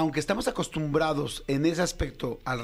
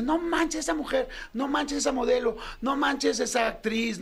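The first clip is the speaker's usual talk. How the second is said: much higher, stretched amazed cry; awestruck exclamation; 10 semitones higher than usual. Retold or imitated. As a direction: gush over her like a fan. Men gawking at beautiful women.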